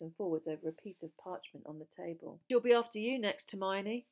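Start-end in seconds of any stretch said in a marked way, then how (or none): none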